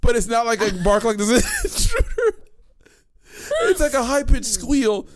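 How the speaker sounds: high, giggly tearful voice; laughing to tears. Real, not acted. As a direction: still laughing while explaining. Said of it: "You're covered in tears".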